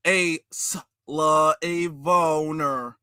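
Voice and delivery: very slow, broken-up syllables in mockery; mock sounding out. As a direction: imitate a laboured beginner reader. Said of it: "he's sounding it out".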